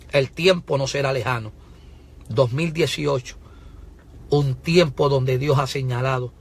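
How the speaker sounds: normal talking voice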